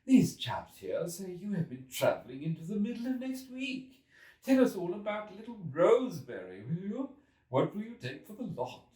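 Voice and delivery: high, loud jeering editor voice; the editor ridiculing the returned traveller; hilarious editor. A narrator's reading. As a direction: tease him with mocking glee. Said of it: "said the editor hilariously".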